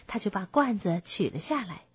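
ordinary delivery, nothing marked

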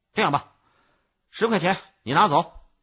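loud, hearty, big-hearted offer; feigned generosity. A narrator's reading. Peddler names his price to the child, falsely generous. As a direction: announce it boldly, as if doing a favour. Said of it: "小贩故作豪爽地说".

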